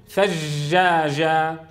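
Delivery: ثجاجة with ج running on as "zh"; slack jeem. Shown as a demonstration. Teacher gives the faulty example, slack flowing ج in ثجاجة. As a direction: soften each ج in ثجاجة into a continuous "zh". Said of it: "حتى يجري فيها الصوت".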